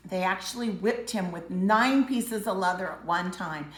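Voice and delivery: ordinary delivery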